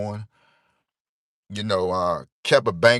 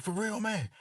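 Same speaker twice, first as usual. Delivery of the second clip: get hype; 12 semitones higher, 8 dB quieter than usual. Retold or imitated. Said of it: "he'll hear me get hype".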